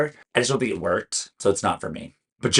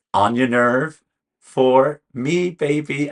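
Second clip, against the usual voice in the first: hyped verdict catchphrase; 6 semitones higher, 5 dB louder, much slower than usual. Acted. Announcing her verdict catchphrase.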